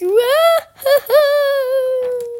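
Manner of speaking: very high loud wail; howling sob. Acted. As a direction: howl in misery. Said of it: "und heulte noch lauter".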